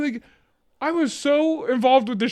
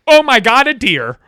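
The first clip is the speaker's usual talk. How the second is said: startled shout; 8 dB louder than usual. Retold or imitated. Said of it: "Then Sharon goes".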